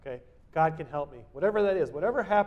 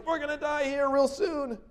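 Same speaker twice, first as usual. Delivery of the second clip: mock panic, raised pitch; panicking disciples; 10 semitones higher, 4 dB louder than usual. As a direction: act out frightened men in a storm. Disciples waking Christ.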